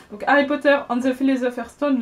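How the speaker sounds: ordinary talk, nothing marked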